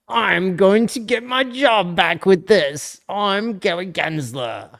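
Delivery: slow, put-on non-American accent, starting high; mock character voice in a slow foreign accent; Gary Gensler. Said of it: "why he doesn't have an American accent".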